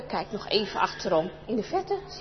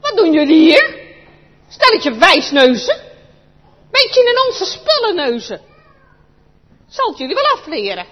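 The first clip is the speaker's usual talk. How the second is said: put-on a crook scaring off nosy kids; angry crook; 4 semitones higher, 13 dB louder than usual. Crook to the children, loud angry threat.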